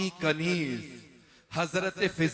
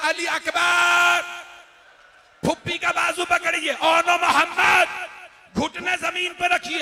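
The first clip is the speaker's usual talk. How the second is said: preaching at full force; 10 semitones higher, 10 dB louder than usual. Acted. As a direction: call each name out like a grieving chant. Husain's commands at full force.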